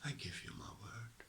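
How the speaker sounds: breathy whisper of a promise; hushed vow. Real, not acted. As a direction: whisper it close to the mic. Spiritual talk, repeated mantra.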